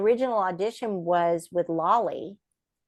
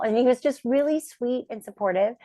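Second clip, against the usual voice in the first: ordinary talk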